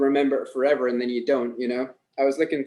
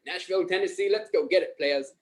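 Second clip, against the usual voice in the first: higher, lightly voiced rallying guy; hyped-up guy; 4 semitones higher than usual. Guy rallying sellers in Nashville, imitated hype. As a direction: imitate a guy pumping up the team. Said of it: "that guy was like".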